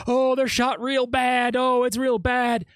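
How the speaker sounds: sarcastic imitation of a flat 911 caller; mocking his 911 call. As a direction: parody a man faking distress on the phone. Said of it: "he was like".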